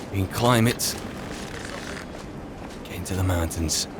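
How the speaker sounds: ordinary talk, nothing marked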